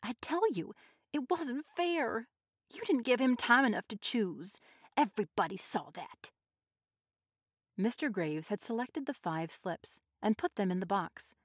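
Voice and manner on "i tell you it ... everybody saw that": high, held-back pleading; restrained protest. A narrator's reading. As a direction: protest without shouting, pitched up. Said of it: "as quietly as she could".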